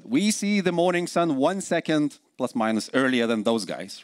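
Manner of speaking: normal talking voice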